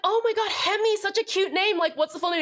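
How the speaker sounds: high, gushing friend voice; a friend gushing over the dog's name. Retold or imitated. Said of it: "And they'd be like".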